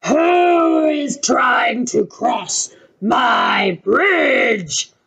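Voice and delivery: slow booming troll shout. A narrator's reading. Troll yelling at the goat on his bridge, slow shout.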